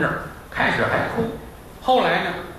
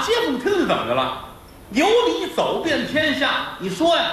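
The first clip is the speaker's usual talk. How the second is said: high, fast, combative retort; hammed-up indignant husband; defiant husband; 9 semitones higher than usual. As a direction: fire back, righteous and pushy. Husband argues back.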